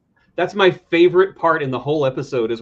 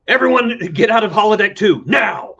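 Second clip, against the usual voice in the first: loud captain's order; shouted mock captain's command; 8 semitones higher, 7 dB louder than usual. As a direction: bark it like a captain clearing the room. Janeway ordering crew out.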